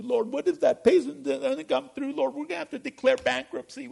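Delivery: high, panicked pleading to the Lord; anxious worrier. Retold or imitated. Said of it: "illustrate what meditation is in the negative".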